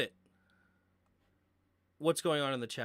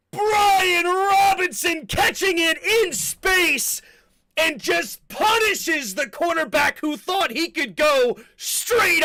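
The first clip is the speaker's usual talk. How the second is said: high, loud football play-by-play, key words stretched out; shouted touchdown call; 12 semitones higher, 10 dB louder, slower than usual. Real, not acted. Commentator celebrating Washington's touchdown run, shouted play-by-play. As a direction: roar the big play, drawing out the names.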